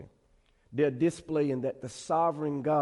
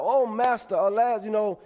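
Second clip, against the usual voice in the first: mild mock scream, raised and louder; retold cry of alarm; 5 semitones higher, 4 dB louder than usual. Axe-swinger cries to his master over the lost borrowed axe, mild retold scream. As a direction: cry out in dismay, half acted. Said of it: "he screams out".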